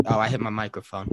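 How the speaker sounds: usual speaking voice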